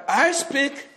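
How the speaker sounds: ordinary talk, nothing marked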